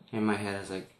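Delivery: ordinary talk, nothing marked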